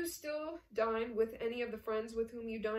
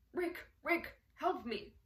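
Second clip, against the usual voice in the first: high urgent cry for help, acted; desperate plea; 5 semitones higher, slower than usual. Ugart grabs Rick while fleeing guards, pleading desperately. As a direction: beg urgently for rescue. Hunted man begs for help.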